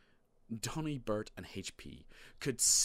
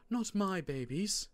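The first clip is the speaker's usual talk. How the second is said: high, slow motherly coo; doting mum; 10 semitones higher, 4 dB louder than usual. A narrator's reading. Mum hugging her youngest boys, high doting protest. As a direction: draw it out high, protecting the little ones. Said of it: "said Mum, hugging the younger brother squad".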